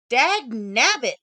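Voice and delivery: loud, slow exasperated outburst; frustrated curse. Acted. Programmer cursing at her error-filled monitor, loud and exasperated. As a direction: bark it at the broken screen.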